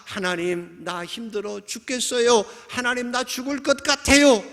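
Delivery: high, desperate appeal; pleading to God. Acted. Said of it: "화소연하고". (높은 목소리로 하나님께 하소연하듯)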